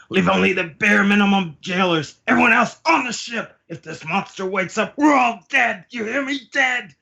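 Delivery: very high, frantic hammed-up orders; panicked command. Crew ordered aboard before monster wakes up, high panicked cries. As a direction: yell it as if lives depend on it.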